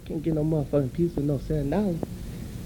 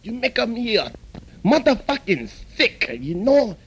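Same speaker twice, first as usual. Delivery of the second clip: the owner snapping back in a broad put-on Chinese accent; angry mock Chinese accent; 4 semitones higher than usual. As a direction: scold the rude customer in the mock accent. Owner argues.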